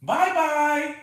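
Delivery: very high bye-bye to the dog; high playful pet farewell. Real, not acted. Baby talk to dog.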